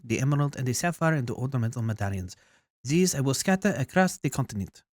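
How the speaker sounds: emphatic French queen laying out a plan; bad French accent. Queen plotting to scatter the medallions, bad French accent. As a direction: put on an amateur French accent. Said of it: "probably a really bad French accent".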